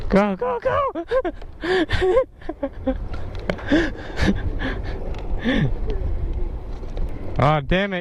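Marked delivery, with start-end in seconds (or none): 0.1-0.9 excited yell. Real, not acted. Cheering at the light. 0.9-7.3 high wheezing laugh. Real, not acted. Wild laugh.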